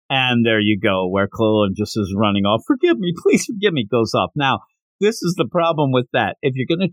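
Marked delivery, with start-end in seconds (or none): 2.7-3.9 pleading. Retold or imitated. Krillin runs off caught cheating.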